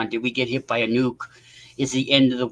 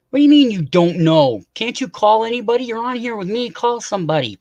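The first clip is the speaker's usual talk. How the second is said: loud, high exasperated demand; 9 semitones higher, 4 dB louder than usual. Real, not acted. Urging a friend to call about the outage, loud exasperation. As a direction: push him to pick up the phone.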